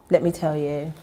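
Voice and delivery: ordinary talk, nothing marked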